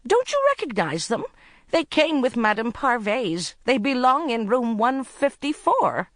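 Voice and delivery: high, urgent, insistent appeal; desperate insistence; pleading. A narrator's reading. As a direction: make him see the danger. Woman pleading, being abducted.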